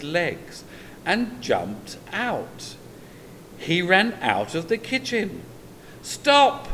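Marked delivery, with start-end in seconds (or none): 6.0-6.7 high, loud yell of stop. A narrator's reading. Seth yelling at the runaway cookie, loud high shout. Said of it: "yelled Seth".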